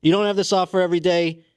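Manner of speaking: high, furious rant at the studio execs; full-on meltdown. Acted. Pitch rejected, ranting furiously at the network execs. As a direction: lose it completely at the execs. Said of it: "have a full-on meltdown".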